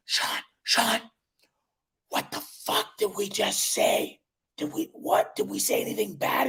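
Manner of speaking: urgent hushed whisper, voice barely there; frantic whisper to a buddy off camera; panicked whisper. Retold or imitated. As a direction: hiss it so the call can't hear.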